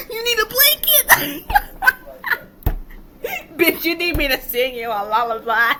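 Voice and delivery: high-pitched giggling mockery, words squeezed out between laughs; cackling at an angry stranger while taunting them; helpless laughter. Real, not acted. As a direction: laugh right through the taunts, unable to stop. Mocking an angry commenter, cackling.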